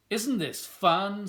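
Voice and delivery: slow, high and loud delight; gleeful gloat. Acted. Wendell delighting in finger painting, slow, high and loud. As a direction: relish the mess out loud.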